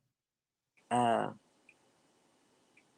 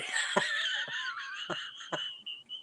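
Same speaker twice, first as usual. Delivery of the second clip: high-pitched burst of laughter; real laughing fit; 5 dB quieter than usual. Real, not acted. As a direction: laugh helplessly, high and long. Laughing at the blanket question.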